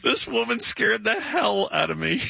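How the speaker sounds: high, slow mock-shocked quote; drawn-out retelling. Host repeating doorman's punchline to the crew, drawn out. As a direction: stretch the punchline out, landing on "hell".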